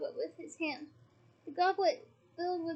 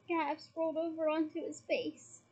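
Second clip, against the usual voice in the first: plain reading voice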